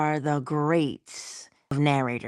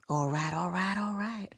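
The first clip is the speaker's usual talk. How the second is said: McConaughey impression; 2 semitones higher, 7 dB quieter than usual. Naming unique voices, a light McConaughey imitation. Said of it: "We can say Matthew McConaughey".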